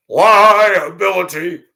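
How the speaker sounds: one slow, loud, high word; heavy emphasis. Real, not acted. Warning viewers off dating, slow loud emphatic word. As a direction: land the word like a verdict.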